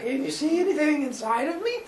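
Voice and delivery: high, odd-voiced question; curious Zeus. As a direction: ask it in a funny high voice. Zeus asks about his head.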